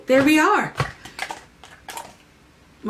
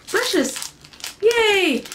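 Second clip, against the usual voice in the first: excited; 10 semitones higher than usual. Real, not acted. Excited unboxing cheer.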